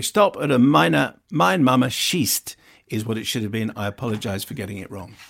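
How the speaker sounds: normal talking voice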